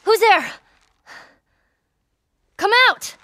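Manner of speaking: sharp, high shout into the trees; wary challenge. Acted. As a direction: call out hard at hidden strangers. Armed hunter challenging hidden strangers.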